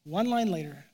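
usual speaking voice

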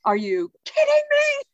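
hammed-up furious disbelief; mock outrage. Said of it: "He's like".

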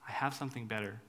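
low, soft and tender; God meeting us in the gutter, gently; gentle offer. Retold or imitated. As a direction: offer it softly like a friend. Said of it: "he says".

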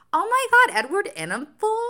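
put-on very high, loud Italian-accented gasp; mock Italian excitement. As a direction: squeal the name like a starstruck Italian editor. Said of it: "What's an Italian accent?".

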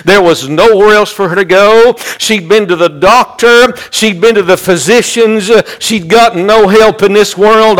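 rhythmic, driving sermon delivery; fervent preaching. Real, not acted. Preacher urging the congregation that he knows, fervent preaching. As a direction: preach it with insistent repeated cadence.

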